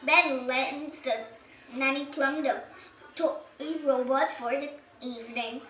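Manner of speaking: ordinary delivery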